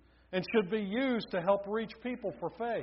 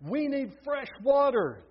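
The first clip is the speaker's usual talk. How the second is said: louder, high insistent call for fresh water; 8 semitones higher, 6 dB louder than usual. A narrator's reading. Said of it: "they called back".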